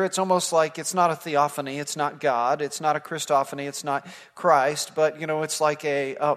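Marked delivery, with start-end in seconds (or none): none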